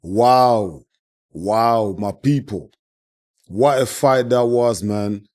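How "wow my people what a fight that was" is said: ordinary talk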